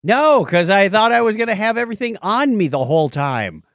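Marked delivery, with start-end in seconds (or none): none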